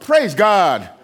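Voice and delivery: rising, emphatic pulpit delivery; emphatic sermon cadence building to a refrain; preaching. Acted. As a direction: preach it with full conviction.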